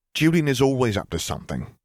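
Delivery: dismissive retort. A narrator's reading. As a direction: brush the news off as nothing new. Pansy dismisses the news, eyes narrowed.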